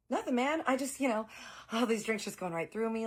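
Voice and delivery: lightly voiced guy caught out, squirming; nervous Bob. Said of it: "Bob is like".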